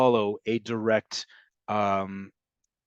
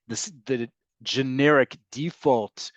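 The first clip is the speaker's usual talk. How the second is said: ordinary delivery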